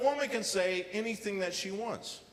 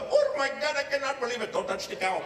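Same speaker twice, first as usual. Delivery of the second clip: loud, high mock Indian accent; hammed-up Indian accent, excited and shocked; over-the-top Indian accent yelp; 7 semitones higher, 5 dB louder than usual. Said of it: "not that kind of Indian".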